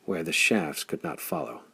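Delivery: usual speaking voice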